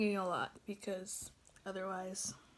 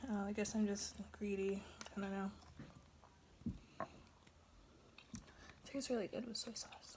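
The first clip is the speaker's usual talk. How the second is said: whispering; 2 semitones lower, 9 dB quieter than usual. Real, not acted. Whispered mukbang talk.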